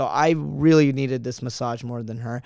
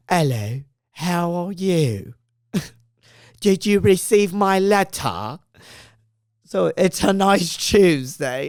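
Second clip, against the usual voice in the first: slow, higher, hammed-up British accent; 5 semitones higher, 4 dB louder, slower than usual. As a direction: put on a playful, drawn-out British accent. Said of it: "speaking in a British accent".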